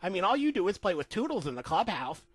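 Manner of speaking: high-pitched cartoon Minnie; squeaky mouse wife ending the marriage. Wife telling Mickey she wants a divorce, high cartoon voice. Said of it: "Minnie said".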